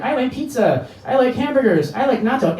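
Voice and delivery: put-on high, sing-song child recital; kids reciting. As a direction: recite it like little first graders. Small kids reciting favorite foods.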